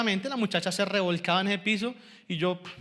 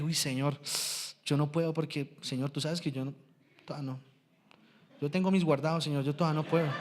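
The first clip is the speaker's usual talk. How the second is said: timid inner prayer; 4 semitones lower, 5 dB quieter than usual. Retold or imitated. Voices his timid silent prayer.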